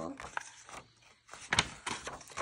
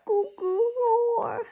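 put-on higher baby goo goo; baby babble; 9 semitones higher than usual. Lily recalling herself as a baby cub, higher babble. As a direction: coo like a little baby.

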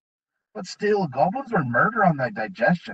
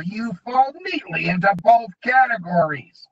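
goblin menace; 9 semitones higher, 4 dB louder than usual. A narrator's reading.

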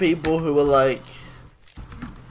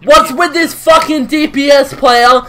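high, loud furious yell; angry shout; 8 semitones higher, 7 dB louder than usual. Real, not acted. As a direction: rage at the spawn camper. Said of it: "Dude, you sound like".

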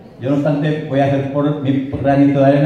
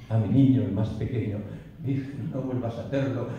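poem recitation; 2 semitones lower, 6 dB quieter than usual. Acted. The lover confesses the secretly kept handkerchief, hushed recitation. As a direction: confide it softly, line ends fading almost to nothing.

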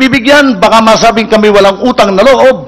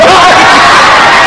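airy laughing burst; 9 semitones higher, 5 dB louder than usual. Real, not acted. Comic punchline laugh.

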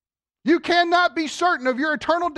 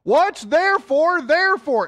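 southern accent; 4 semitones higher, 5 dB louder than usual. Shown as a demonstration. Preacher quizzing church on the therefore, southern accent. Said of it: "say it with a southern accent".

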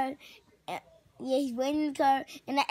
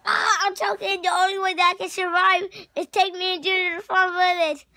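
fake choking; 4 semitones higher, 9 dB louder than usual. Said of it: "time to act like I'm choking".

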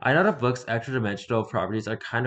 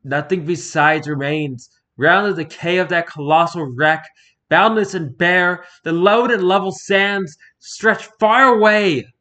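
higher and much louder, slow and building; swelling cry; 7 semitones higher, 10 dB louder than usual. Acted. The narrator ends the poem building to a loud, slow cry. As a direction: let the final lines swell into a cry.

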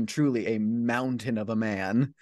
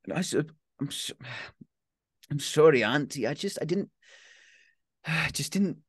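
put-on higher, halting teenage boy close to tears; choked-up teen; 5 semitones higher than usual. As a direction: stumble as if crying. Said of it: "he can't finish the word".

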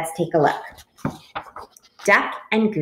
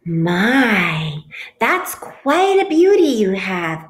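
calling out; 2 semitones higher, 6 dB louder, slower than usual. A narrator's reading. Bluebird calls from across the river, loud admiration. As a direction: project it, stretched on the first word. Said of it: "called a bluebird from across the river".

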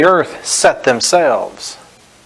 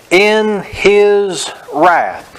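drawn out; 5 semitones higher, much slower than usual. Shown as a demonstration. Preacher reading verse, then repeating.

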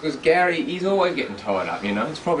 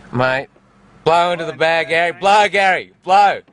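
mock police order; 4 semitones higher, 11 dB louder than usual. Mock breath test on the goat.

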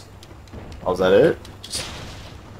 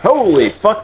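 startled yelp; 10 semitones higher, 3 dB louder than usual. Real, not acted. Sudden dog enemy appears.

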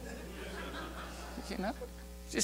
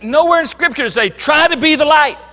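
NOWHERE hit hard, faster, rising into "Try to be the light"; emphatic rebuttal; 5 semitones higher, 4 dB louder than usual. Real, not acted. Preacher insisting to the congregation, emphatic and louder. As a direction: drive the point home with force.

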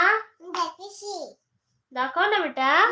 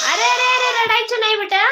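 calling out high and loud over the crying; soothing call; 7 semitones higher, 4 dB louder than usual. Real, not acted. Toddler starts crying, mother soothes.

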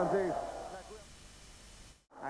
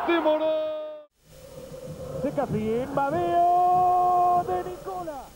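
shouted goal call; 8 semitones higher, much slower than usual. Real, not acted.

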